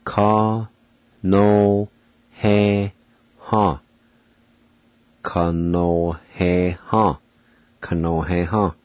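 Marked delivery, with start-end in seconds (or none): 0.1-3.8 ka no he ha split into separate syllables with pauses. Shown as a demonstration. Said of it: "it's pronounced". 5.2-7.2 Ka no he ha said slowly and carefully, every syllable clear; slow careful pronunciation. Shown as a demonstration. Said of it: "it's pronounced".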